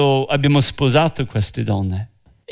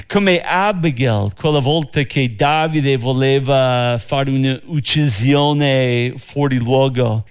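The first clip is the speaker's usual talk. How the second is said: ordinary talk, nothing marked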